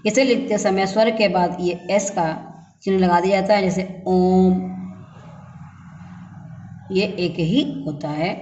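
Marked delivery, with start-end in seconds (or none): 4.1-4.7 ओम with the vowel held very long and slow; prolonged vowel. Shown as a demonstration. Teacher showing the extra-long pluta vowel, drawn out slowly. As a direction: stretch ओम out, holding the ओ. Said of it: "दीर्घ स्वर में से भी अधिक समय लगता है".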